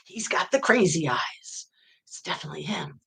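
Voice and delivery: hushed conspiratorial whisper. Retold or imitated. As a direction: whisper it like a secret. Retold thought, spoken secretly.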